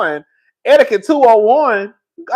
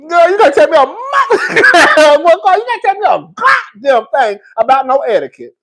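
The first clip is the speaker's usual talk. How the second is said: dramatic loud rant; 9 semitones higher, 7 dB louder than usual. Real, not acted. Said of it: "sometimes I'm dramatic".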